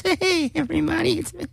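high falsetto mock greeting; put-on character voice. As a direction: pretend your real voice is a squeaky falsetto. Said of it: "I probably talk like this".

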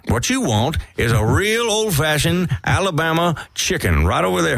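high, swooping Southern rooster holding forth; big put-on rooster twang; Foghorn Leghorn. Shown as a demonstration. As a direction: stretch it out like a blustering cartoon Southerner. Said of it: "let's hear Foghorn Leghorn".

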